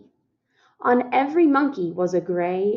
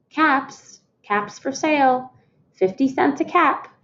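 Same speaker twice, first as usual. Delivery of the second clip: higher street-seller call; 6 semitones higher than usual. A narrator's reading. Peddler calling caps for sale through the town. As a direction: sing out your wares to the town. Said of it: "he walked back to the town calling".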